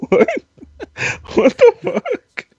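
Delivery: high, drawn-out disbelief; incredulous. Real, not acted. Guest stunned by the host's rude remark, incredulous. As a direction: stretch it out, genuinely stunned.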